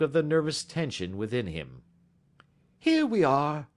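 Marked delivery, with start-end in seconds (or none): 2.8-3.7 bright, lifted greeting, a bit louder; mildly cheery host; cheerily. A narrator's reading. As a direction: welcome them in cheerfully. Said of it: "said Holmes cheerily".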